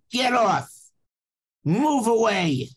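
high-pitched mock yell of a guard; imitated guard's yell, drawn out at the end; retold yell. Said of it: "And he yelled at me again".

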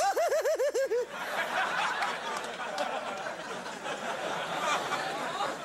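long, high manic babbling noise; hammed-up lunatic noise, no words; crazy California impression. Host mimicking a crazy state for the audience, manic wordless noise. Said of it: "That's my impression of the state of California".

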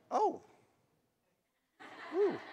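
high-pitched smitten "oh"; smitten surprise. Retold or imitated. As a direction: coo it high, reliving love at first sight. Reacting to first sight of wife.

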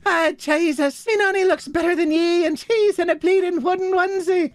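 high-pitched put-on Irish accent. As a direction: play an Irish speaker, pitched well up. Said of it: "In the sentence".

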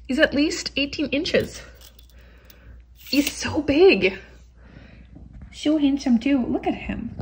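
drawn-out, amazed and fond; slow marvelling. Real, not acted. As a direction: stretch it out in awe. Marvelling at the big cat.

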